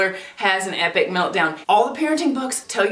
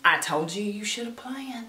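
softly scolding mock Southern grandma; 8 dB quieter than usual. Imitating a grandma scolding over poor planning, softly. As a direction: chide quietly like a Southern granny. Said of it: "Like your Southern grandma".

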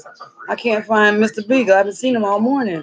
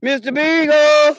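calling out; 5 semitones higher, 3 dB louder, slower than usual. Real, not acted. Calling for lost dog.

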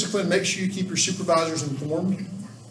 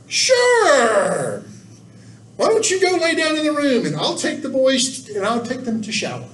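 cheerful Bob; 8 semitones higher than usual. Retold or imitated. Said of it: "And Bob said".

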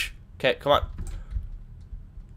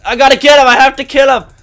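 loud and urgent; excited yelling; 7 semitones higher, 9 dB louder, faster than usual. Real, not acted. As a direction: shout it, hungry for the kill. Gamer chasing an enemy.

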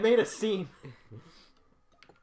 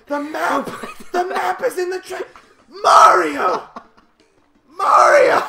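frantic high shouting, rising to a scream; yelling; 12 semitones higher, 15 dB louder, slower than usual. Acted. Player yelling at the character who threw away the map, shouting. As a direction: scream the name at the top of your lungs. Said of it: "I really hurt my voice".

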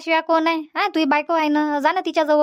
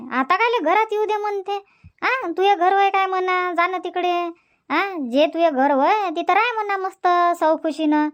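higher voice of a scolding neighbour; neighbour woman; 5 semitones higher than usual. Acted. Neighbour Shantabai scoffs at the son, higher voice. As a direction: scoff like the woman next door.

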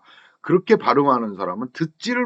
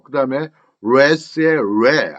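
ordinary talk

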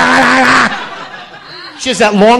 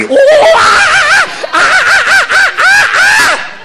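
monkey hoots. Retold or imitated. Imitates a monkey swinging.

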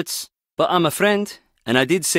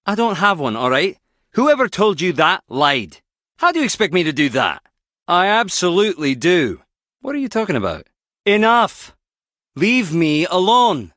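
heated, higher-pitched denial; angry outburst; 4 semitones higher than usual. Acted. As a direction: push back hard, indignant and fed up. Acted quarrel, angry denial.

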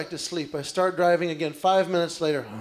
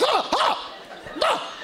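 much higher panicked yelps; freaked-out yelps; slower than usual. Retold or imitated. Husband freaking out in the dark car, high panicked yelps. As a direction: yelp like something crawled on you. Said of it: "I'm like".

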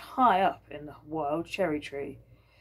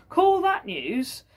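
higher, louder, very slow scoff; scoffing mule; 7 semitones higher, 5 dB louder, much slower than usual. Acted. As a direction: drawl it like an unimpressed mule. The mule scoffing, voiced by Anna.

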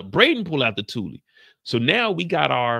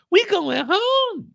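mock hopeful; 4 dB louder, much slower than usual. Tasha voiced hopeful.

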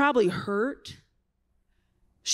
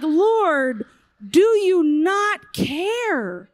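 loud, slow, drawn-out hurt cry; hurt plea; 3 semitones higher, 7 dB louder, much slower than usual. Retold or imitated. Martha pleading to Jesus, wounded and loud. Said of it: "She was so hurt".

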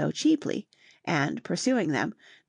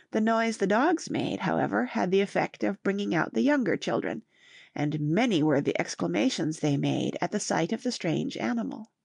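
usual speaking voice

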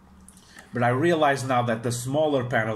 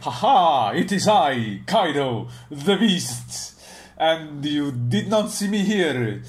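put-on loud, higher-pitched hammy Italian accent; accidental Italian accent; 3 semitones higher, 3 dB louder, slower than usual. Kaido revealing himself to arriving foes, mock Italian accent. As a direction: play a grand villain sliding into Italian accent. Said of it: "Italian accent".

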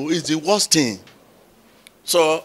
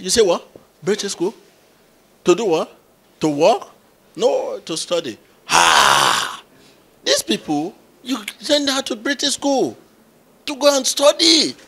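incredulous exclaim; 6 semitones higher, 5 dB louder than usual. Retold or imitated. Pastor retells incredulous reaction.

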